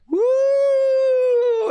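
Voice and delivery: high whooping cheer; excited woo. Real, not acted. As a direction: let out a wild woo. Cheering the broken table.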